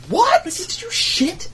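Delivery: high-pitched incredulous question; squeaky mock outrage; mock disbelief. Imagined reaction of outraged moviegoers.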